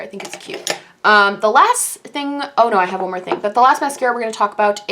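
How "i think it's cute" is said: soft unvoiced aside; a hushed little aside; whispered. Real, not acted. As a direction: whisper the verdict to yourself. Quiet verdict on mascara.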